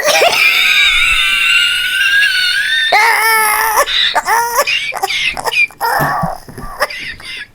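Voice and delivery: long high wheezing laugh; breathless shrieking giggle fit; helpless laughter. Real, not acted.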